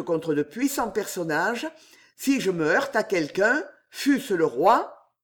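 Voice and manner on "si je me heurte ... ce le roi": ordinary delivery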